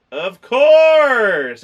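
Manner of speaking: loud, high, drawn-out sarcastic "Of course!". Real, not acted. Reviewer scoffing at a predictable plot, loud and sarcastic. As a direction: roll your eyes out loud at the obvious twist. Sarcastic reaction to movie twist.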